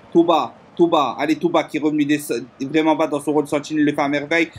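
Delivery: fast live play-by-play, building to a shout; esports caster. Acted. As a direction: call the move, then yell the goal.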